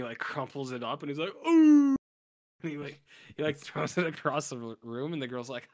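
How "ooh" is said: mock effortful "ooh", very high; hammed-up high, loud hoot; loud high whoop. Brother flinging the valentine, loud high whoop.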